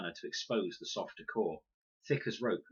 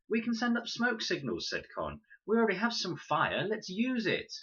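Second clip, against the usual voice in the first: put-on high, louder girl's voice; a girl pushing her idea, pitched up; Con, eager; 12 semitones higher, 3 dB louder than usual. A narrator's reading. Con proposing smoke signals, high and eager. Con's voice in read story.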